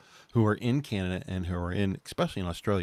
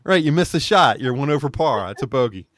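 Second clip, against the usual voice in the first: excited realisation; 7 semitones higher, 11 dB louder than usual. Real, not acted.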